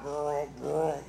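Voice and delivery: low imitated vroom of a machine; rolling motor hum made by mouth; machine noise. Preacher imitating big taffy machines, rumbling vroom.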